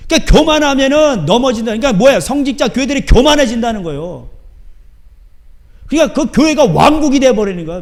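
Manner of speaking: full-force pulpit preaching; fiery preaching. Acted. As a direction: preach it hard.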